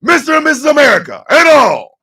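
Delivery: big booming show-opening announcer, stretched words; announcer intro. Acted. Host opening the morning show to viewers, booming announcer. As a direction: boom it out like a ringmaster.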